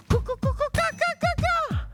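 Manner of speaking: high cooing bird sounds; bird calls. Retold or imitated. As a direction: coo and chatter like birds.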